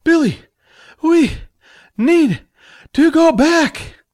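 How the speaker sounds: panting between words; out of breath. Acted. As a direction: gasp it out, winded. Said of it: "He puffed and panted".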